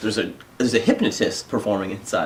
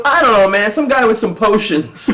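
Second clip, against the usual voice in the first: laughing shrug; 9 semitones higher, 7 dB louder than usual. Retold or imitated. Coworker shrugging.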